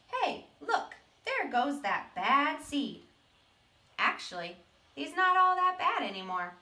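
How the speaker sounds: lightly voiced voices of others; quick playful bystander voices; passersby and seed. Teacher voicing people who point at the seed, lightly.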